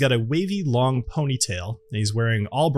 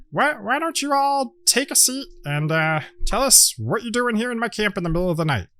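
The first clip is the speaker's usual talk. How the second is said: boastful gnome; 8 semitones higher than usual. Acted. Indiana Gnomes introduces his friends, higher and proud. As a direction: show off your crew like a showman. Gnome invites them to sit.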